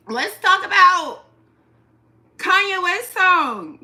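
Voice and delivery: slow, high, loud hype call; loud announcement. Real, not acted. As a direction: announce it like a show host.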